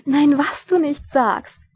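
high, loud, drawn-out mock amazement; giggly amusement. A narrator's reading. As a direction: exclaim it with amused delight, stretching the words. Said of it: "kicherte liebe Lady Sybil".

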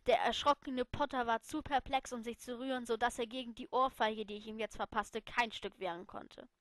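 plain reading voice

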